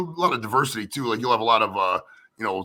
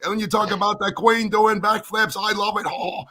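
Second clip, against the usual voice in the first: high, slow, loud gushing; mock gushing fan; 9 semitones higher, 5 dB louder, slower than usual. Mimicking a YouTube friend gushing over Joey, high and slow. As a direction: gush over him in a high drawn-out voice. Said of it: "she's always like".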